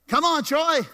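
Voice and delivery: loud, high call to come up. Real, not acted. Pastor calling Troy up to the stage, loud and high.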